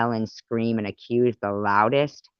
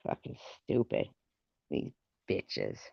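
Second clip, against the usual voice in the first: soft, voiceless mutter under breath; whispered aside; 9 semitones lower, 13 dB quieter than usual. Real, not acted. Muttering to herself after the rant. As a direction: say it to yourself under your breath.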